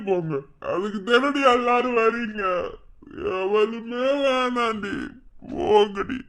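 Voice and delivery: loud, slurred heartbroken shouting; drunken anguished rant. Acted. Heartbroken husband drunkenly raging at the wife who left. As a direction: rage and grief at her, half-drunk.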